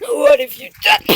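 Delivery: high, loud mock horror; hammed-up high shout; mock outcry. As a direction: cry out in pretend alarm. Acted cry to Stephen.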